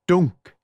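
loud thudding knock sound; imitated knock. A narrator's reading. Narrator voicing Julie's fists on the door, loud knock. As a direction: say it like a fist on wood.

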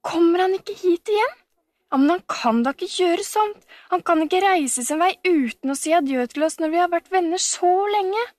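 high, protesting disbelief; dismayed cry. A narrator's reading. Camilla upset he left without goodbye, crying out. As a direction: object in dismay, pitched well up. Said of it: "ropte Camilla".

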